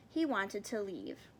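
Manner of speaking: ordinary delivery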